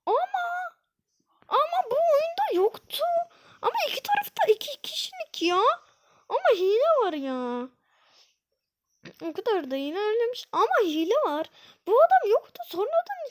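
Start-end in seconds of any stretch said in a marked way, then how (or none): 0.1-7.7 high, whining complaint; whiny protest. Real, not acted. Gamer whining to viewers that the level is unfair. 11.9-13.3 loud, higher indignant cry; indignant outburst. Real, not acted. Gamer loudly protesting at a new enemy.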